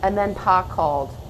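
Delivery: put-on gentle, hushed read-aloud of a children's story; story narration. A narrator's reading. Reads a picture book aloud.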